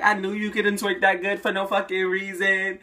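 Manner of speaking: high and loud, teasing and thrilled; playful excited yell. Retold or imitated. Sister teasing her brother after he comes out, excited yell. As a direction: crow it at him, still buzzing. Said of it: "She went crazy. But just playing around".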